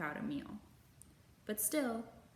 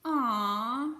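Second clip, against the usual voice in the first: a loud, drawn-out adoring aw; tender aw; 3 semitones higher, 10 dB louder than usual. A narrator's reading. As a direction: coo over the cute chicks. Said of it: "said Baby Bear".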